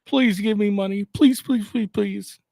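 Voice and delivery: high, whiny pleading; mock begging. Mocking Zelensky begging America for money, whiny. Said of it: "was begging for money".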